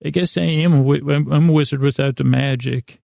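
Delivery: normal talking voice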